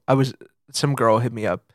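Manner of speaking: ordinary talk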